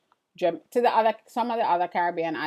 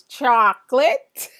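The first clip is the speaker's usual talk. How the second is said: put-on "Chocolate" drawn out slowly in a higher, louder New York accent, with a laugh; 6 semitones higher, 10 dB louder, slower than usual. Host imitating her son saying "Chocolate" to show her New York accent, slow and amused. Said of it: "until your son says".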